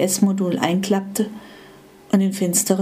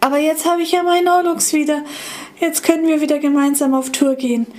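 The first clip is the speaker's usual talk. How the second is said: higher, fast happy voice; old iPod happy to have its friend back; delighted; 6 semitones higher, faster than usual. Acted. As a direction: rush it out with joy. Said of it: "freute sich der iPod".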